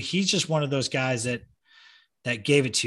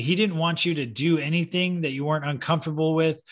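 normal talking voice

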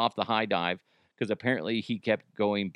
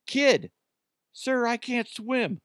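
put-on whiny kid voice; 12 semitones higher, slower than usual. Voicing scared kid.

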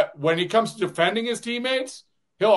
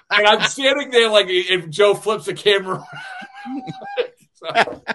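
words bubbling with laughter, higher; chuckling as he wraps up the story; laughing while talking; 4 semitones higher than usual. Real, not acted. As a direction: laugh through the story's punchline.

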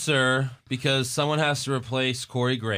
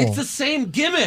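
tag; 10 semitones higher than usual. Real, not acted. Arguing over two tag teams.

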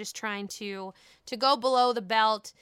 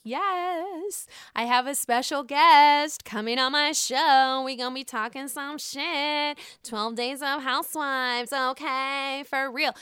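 excited; 3 semitones higher, 4 dB louder than usual. Real, not acted. Hyped guest announcement.

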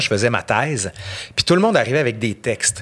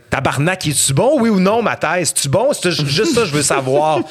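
exasperated outburst; 4 semitones higher than usual. Retold or imitated. To the thesis jury, a mock exasperated outburst. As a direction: blurt it out like you've had enough. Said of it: "j'avais envie de dire".

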